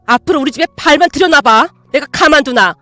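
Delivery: loud enraged tirade; furious yelling. Acted. Mother throwing daughter-in-law out, loud enraged yelling. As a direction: throw her out in a rage. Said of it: "소리치셨어요".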